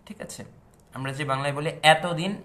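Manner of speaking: ordinary delivery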